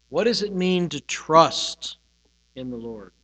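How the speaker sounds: "trust" weighted and set apart by a pause; stress on trust. Shown as a demonstration. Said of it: "meditate on that word trust".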